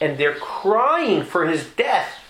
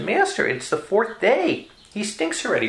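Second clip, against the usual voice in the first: Martha protesting; 7 semitones higher than usual. Acted.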